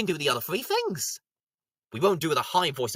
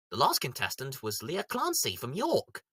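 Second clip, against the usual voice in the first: ordinary talk, nothing marked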